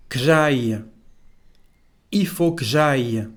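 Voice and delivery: "Que j'aille" said k'zhai, E dropped; contracted que. Shown as a demonstration. Teacher modelling for learners, "Que j'aille" said as k'zhai. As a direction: run the k straight into "j'aille". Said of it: "We don't pronounce the letter E".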